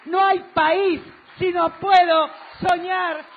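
fervent rally oratory. Real, not acted. Politician telling supporters there is no country without dreams, fervent oratory. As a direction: build a rhythmic list for the crowd.

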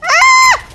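sudden high, loud yelp; startled scream. Real, not acted. Gamer holding on through a close shave, startled scream.

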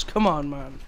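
normal talking voice

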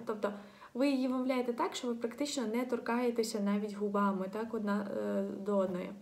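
normal talking voice

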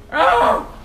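loud, high burst of "oh"; sudden gamer's outcry; startled yell. Real, not acted. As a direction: yelp loudly in shock. Reacting to a missed hit.

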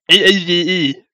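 high, loud, disdainful spelling-out; mock SJW jargon. Real, not acted. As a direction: say the letters as if they embarrass you. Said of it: "I sound like a fucking S J W".

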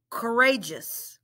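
"Courageous" said smoothly as one word; blended "Courageous". Shown as a demonstration. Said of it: "Let's blend it".

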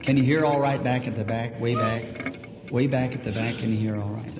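normal talking voice

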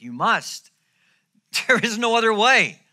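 loud, high-pitched insistence; very emphatic. Acted. Preacher stresses Jesus's command to be born again, very emphatic. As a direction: drive it home at full volume. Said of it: "He's very emphatic".

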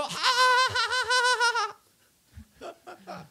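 loud, high mock cackle; imitated hysterical laughter. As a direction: mimic people rolling on the floor laughing. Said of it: "they start laughing".

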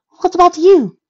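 high, loud anxious question; concerned. Acted. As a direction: ask it with sudden worry. Rarity concerned for her captor.

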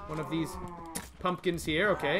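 normal talking voice

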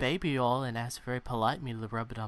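restrained sportscast delivery of racy fiction; golf announcer. Acted. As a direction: read it politely, like commentary on a putt. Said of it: "golf announcers reading Fifty Shades of Grey".

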